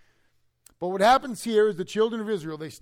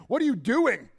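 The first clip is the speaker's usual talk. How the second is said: raised, higher, indignant demand; mock accusation; 5 semitones higher, 5 dB louder than usual. Thirsty crowd accusing Moses, raised mock demand. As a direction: throw the blame at him.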